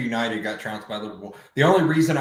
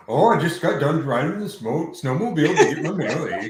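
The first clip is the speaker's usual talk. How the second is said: higher, hammed-up Canadian accent; mock Canadian; 3 semitones higher, 4 dB louder than usual. Joking about being Canadian, hammed-up Canadian accent. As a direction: ham up a chatty Canadian local. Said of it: "that's pretty much Canada".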